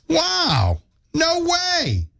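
a high, hammed-up no way; mock amazement. Real, not acted. Host reacting to a silly listener fact.

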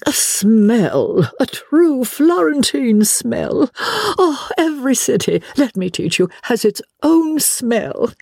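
high, drawn-out gushing exclamation; rapturous cry. Acted. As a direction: cry it out in grand, lingering rapture. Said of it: "and she cried".

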